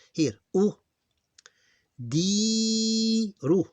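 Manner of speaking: u, di, ru drawn out high, di held long; syllables spelled out. Shown as a demonstration. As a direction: sound out each syllable for the class. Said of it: "reading syllables aloud".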